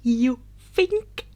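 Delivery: angry retort. Acted. Izuku answers in anger.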